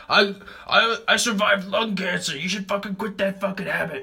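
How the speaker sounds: high, strained mock rant of an angry old man; imitated yelling. Old man scolding smokers on the sidewalk, high mock yell. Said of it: "yells at us. He's like".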